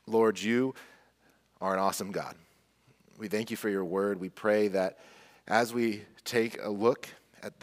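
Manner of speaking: low, soft, reverent praying voice; hushed pastoral prayer. Real, not acted. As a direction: pray softly, unhurried, with pauses between phrases. Leads the congregation in prayer.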